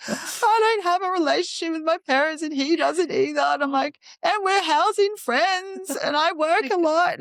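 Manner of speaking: high-pitched retold outburst; mock panicked protest. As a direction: pile up the objections in hammed-up panic. Acted panicked reply to sonographer.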